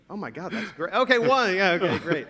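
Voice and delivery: sudden high, loud burst of amused disbelief; amused surprise. Real, not acted. Speaker reacts to audience raising hands, loud and high amused surprise. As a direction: react with a startled, delighted jump in pitch.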